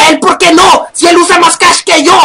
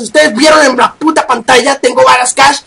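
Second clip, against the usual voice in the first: ordinary talk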